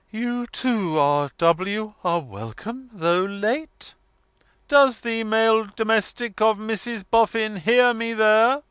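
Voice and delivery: imperious matron, pitched high and loud; grand declamation. A narrator's reading. Missus Wilfer summons the absent menial, grand loud declamation. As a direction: cast it forth into the night. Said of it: "This deep-toned inquiry was cast forth".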